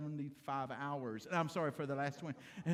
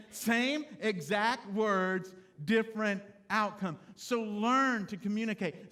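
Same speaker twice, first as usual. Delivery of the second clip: louder, driving sermon delivery; preaching at full force; 4 semitones higher, 7 dB louder than usual. Acted. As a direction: preach it out to the men in the pews. Preaching to congregation.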